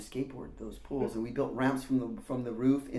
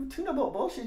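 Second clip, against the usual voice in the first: much higher, rising incredulous repeat of tuna boat bullshit; puzzled echo; 12 semitones higher than usual. Retold or imitated. Teen repeating Randy's insult back, puzzled and rising. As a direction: repeat his words back, lost.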